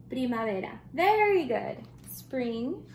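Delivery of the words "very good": excited praise. Real, not acted. Praising children's answer.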